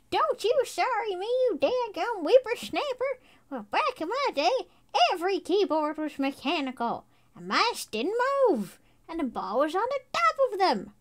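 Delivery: put-on loud, high cranky old geezer; crotchety old-timer yelling at kids; old prospector. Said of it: "Hold on".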